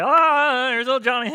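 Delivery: high, loud cooing exclamation; amused party guest. Acted. Partygoer cooing at naked little Johnny, high and delighted. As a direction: coo over the naked toddler.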